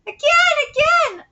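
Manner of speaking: put-on high, eager child voice; excited little kid. Child wanting the funny face repeated, high and excited.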